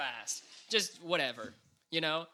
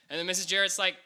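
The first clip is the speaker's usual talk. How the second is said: ordinary talk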